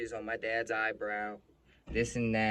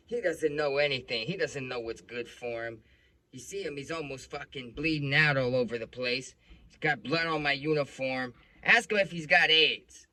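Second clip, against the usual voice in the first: louder, raised voice imitating an irate cop; agitated cop; 5 semitones higher, 10 dB louder than usual. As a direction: retell the cop's angry outburst forcefully. Retold cop snaps about bleeding father.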